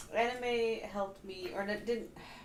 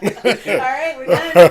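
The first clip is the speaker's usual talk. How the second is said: loud, laughing; 21 dB louder, slower than usual. Real, not acted. Joking end of show.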